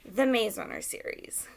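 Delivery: usual speaking voice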